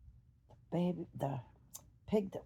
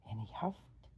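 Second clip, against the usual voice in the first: plain reading voice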